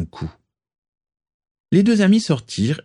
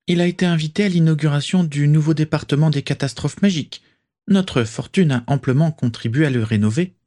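witches chatting; 8 semitones higher than usual. A narrator's reading. Friends' dialogue voiced.

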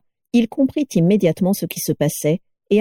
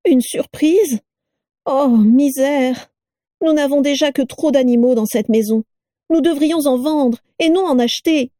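higher mocking wife's voice; mock the new parcel, voice pitched up; shrill sneering Carla; 7 semitones higher than usual. A narrator's reading.

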